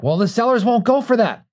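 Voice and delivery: high mock voice of doubting clients; mock protest. Mimicking clients who say the sellers won't agree, high mock whine. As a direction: pitch it way up, mocking their objection. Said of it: "And they're like".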